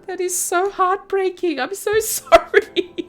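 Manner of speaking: high, hammed-up pitying voice; mock heartbreak. To Marina over her lost bubble tea, hammed-up pity. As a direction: overplay how sad it is.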